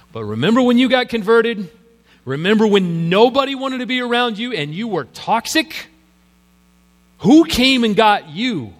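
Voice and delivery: hammed-up heated reminder. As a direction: Barnabas raising his voice at Paul. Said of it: "Barnabas might have said something like this".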